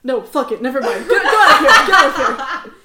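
high, loud outburst breaking into laughter; shouted mock dismissal. [name] abandons his story and sends them away, shouting. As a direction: give up and shoo them out loudly.

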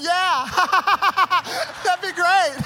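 high, loud forced laugh and agreement; fake enthusiastic laughing. Preacher agreeing to the pastor's scary offer, fake delight. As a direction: overdo the delight, laughing nervously.

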